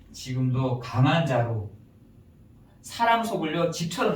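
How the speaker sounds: ordinary talk, nothing marked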